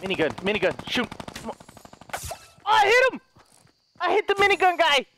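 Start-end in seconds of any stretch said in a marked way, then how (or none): 2.6-5.0 high-pitched excited call-out. Real, not acted. Gamer celebrating a hit on the minigun guy, excited. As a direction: crow over landing the shot.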